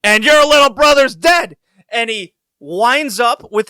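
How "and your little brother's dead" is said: high, loud hammed-up yell; mock wrestler shout. Terry Funk yelling at Dustin, hammed-up shout. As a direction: bellow it like a wrestling heel. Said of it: "he goes".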